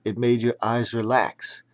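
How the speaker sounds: usual speaking voice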